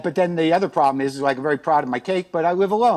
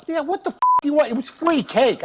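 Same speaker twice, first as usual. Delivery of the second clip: mock indignation; 9 semitones higher than usual. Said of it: "I didn't say that part out loud".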